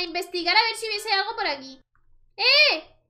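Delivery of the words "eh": loud, high eh on seeing the ghost; sudden alarm; startled shout. Real, not acted. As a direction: call out in surprise. Spots the ghost.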